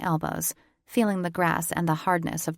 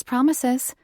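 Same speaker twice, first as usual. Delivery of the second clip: promises lifted higher and louder; teasing lilt; 7 semitones higher, 5 dB louder than usual. A narrator's reading. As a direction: sing promises up high, teasing. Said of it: "I murmured".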